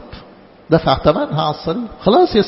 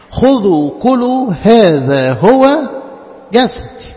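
liturgical intoning; 4 semitones higher, 4 dB louder, much slower than usual. Acted.

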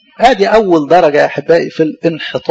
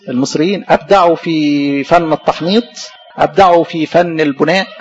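ordinary talk, nothing marked

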